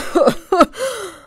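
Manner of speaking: high cackling laugh of the court; loud mocking laugh. The courtiers burst out laughing at the dwarf. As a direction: cackle at the joke. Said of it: "brachen alle sieben in das gleiche Lachen aus".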